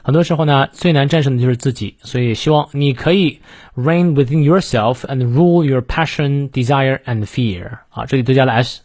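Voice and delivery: ordinary talk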